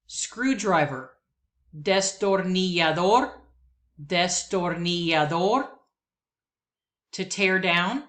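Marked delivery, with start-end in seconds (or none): 1.8-5.7 destornillador, stress on the last syllable DOR; destornillador, rising at the end; Spanish word. Shown as a demonstration. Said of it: "words that I will pronounce".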